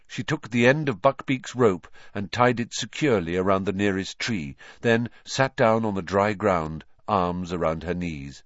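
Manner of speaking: usual speaking voice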